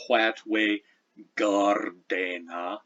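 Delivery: gardena with a hard G, slow; What we gardena as Old English, G as a stop. Shown as a demonstration. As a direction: draw out gardena after a hard G. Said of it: "that's a so-called hard G".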